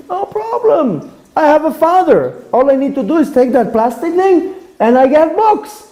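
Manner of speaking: a daughter without a worry, sure her father will pay; carefree little girl. Retold or imitated. Daughter telling the teacher no problem, high carefree child voice.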